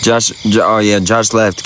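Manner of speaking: ordinary delivery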